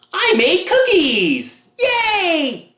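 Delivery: very high, drawn-out, loud squeal of delight; mock gleeful wife. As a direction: impersonate an overjoyed wife, stretched and piercingly high. Imitating wife in weird film scene.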